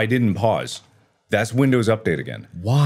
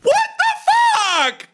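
very high, slow, loud shout; furious shout; 4 dB louder, slower than usual. Real, not acted. Gamer screaming at the screen after dying, high and slow.

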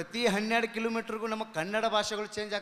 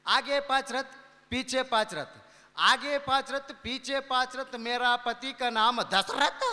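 put-on in Hindi, old woman; 4 semitones higher, 4 dB louder, slower than usual. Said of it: "ಆಕಿ ಹಿಂದಿ ಒಳಗೆ ಹೇಳಕತ್ರಾಕಿ".

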